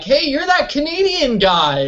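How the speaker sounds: high, eager hello to a stranger; playful overfriendly greeting; mock cheery greeting. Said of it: "I'm like".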